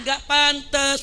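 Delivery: ordinary talk, nothing marked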